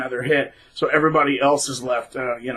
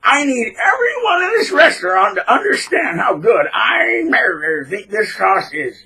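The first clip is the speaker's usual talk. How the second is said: high, loud and slow, booming over the room; hammed-up pompous diner; loud show-off; 8 semitones higher, 5 dB louder, slower than usual. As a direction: proclaim to the whole restaurant. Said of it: "And he'd talk loud".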